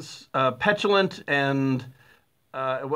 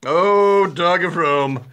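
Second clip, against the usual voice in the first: louder, swooning love-poem cry; mock dramatic declaration; 2 semitones higher, 6 dB louder, much slower than usual. Repeating the insult to the co-host as a mock love-poem cry. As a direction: declaim it like a lovesick poet. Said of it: "Sounds like a love poem".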